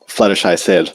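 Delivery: plain reading voice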